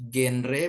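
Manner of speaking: genre read as GEN-re with a hard g; wrong hard-g reading. Shown as a demonstration. Teacher showing students a misreading, genre as hard-g GEN-re. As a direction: say genre as spelt, hard g and clear e's. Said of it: "ini bacanya bukan genre".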